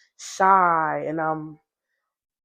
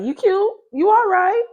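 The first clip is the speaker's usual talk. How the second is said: much higher, coy; shy flirty self; 12 semitones higher, 3 dB louder than usual. Retold or imitated. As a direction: play it coy and high. Her own younger self flirting.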